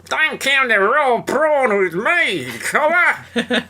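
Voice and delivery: high, slow far-off broad Australian yell; hammed-up Aussie. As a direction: bellow it like a stage Aussie.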